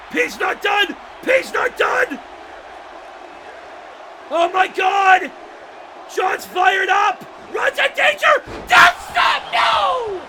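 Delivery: high, loud calls building to a scream; excited shouting. Real, not acted. Commentator calls a comeback.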